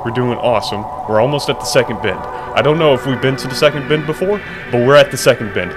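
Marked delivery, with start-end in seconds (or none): none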